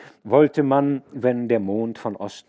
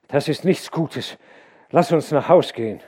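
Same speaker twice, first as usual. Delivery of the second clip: louder, higher, a nervous labourer urging to leave; uneasy day labourer; 7 semitones higher, 9 dB louder than usual. A narrator's reading. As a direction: voice him shivering and keen to go home. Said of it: "Der Tagelöhner steckte die Hände in die Tasche und schüttelte sich".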